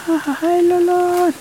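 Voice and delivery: petit rire moqueur plus haut; petit rire du rat. Acted. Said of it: "il fait ce petit rire là". (le rat ricane sur le dos du poulpe, petit rire aigu)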